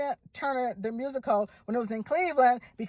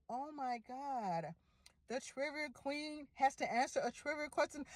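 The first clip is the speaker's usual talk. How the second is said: nervous dread; 13 dB quieter, slower than usual. Retold or imitated. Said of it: "I was like".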